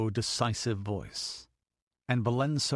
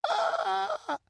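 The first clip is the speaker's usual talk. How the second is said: very high, wavering wail; high anguished child's cry; cry of pain. A narrator's reading. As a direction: wail out in agony. Whipped child cries in pain.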